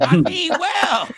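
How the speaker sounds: slow, laughing "I mean well". Real, not acted. Defending his excitement to the cohosts, laughing. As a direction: let it out through a laugh.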